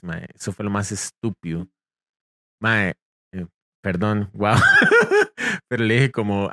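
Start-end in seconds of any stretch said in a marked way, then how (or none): 4.6-5.6 high loud laugh. Real, not acted.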